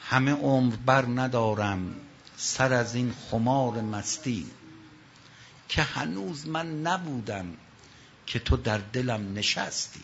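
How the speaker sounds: slow, low, lingering verse; mournful slow recitation; poem recital. Acted. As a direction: let each line of the poem hang, slowly.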